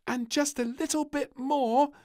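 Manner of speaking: high, loud eager request; piping plea; little princess. A narrator's reading. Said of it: "said the little princess".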